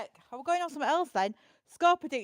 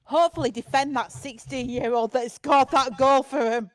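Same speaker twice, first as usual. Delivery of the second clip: put-on Yorkshire accent; 10 dB louder than usual. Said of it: "say to the team in Yorkshire accent".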